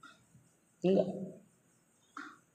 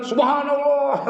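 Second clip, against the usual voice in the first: wife's sigh of dismay; 8 semitones higher, slower than usual. Retold or imitated. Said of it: "Si istri bilang".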